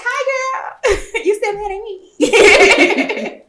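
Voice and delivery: high laughing mock greeting; laughing teasing. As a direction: tease her through laughter. Mock greeting to old rival.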